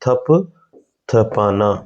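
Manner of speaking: each syllable drawn out very slowly; slow dictation; very slow repeat. Shown as a demonstration. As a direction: stretch the word out for the class. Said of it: "क्या बोल देते हैं?".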